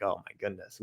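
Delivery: ordinary talk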